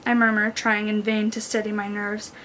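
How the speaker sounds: ordinary delivery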